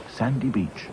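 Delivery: ordinary delivery, nothing marked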